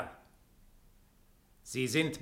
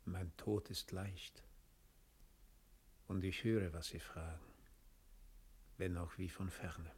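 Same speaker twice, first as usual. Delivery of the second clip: dying, faint; 6 semitones lower, 13 dB quieter than usual. Acted. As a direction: let each word slip away as life goes. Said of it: "mühsam und keuchend".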